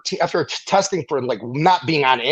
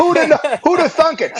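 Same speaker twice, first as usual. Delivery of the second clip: mock amazed; 12 semitones higher, 3 dB louder than usual. Real, not acted. Joking about passing the test.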